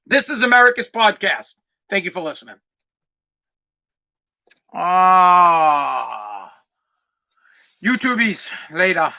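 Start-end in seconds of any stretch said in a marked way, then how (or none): 4.7-6.5 sign-off yell. Acted. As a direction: let out a big drawn-out cry. Sign-off shout at podcast end.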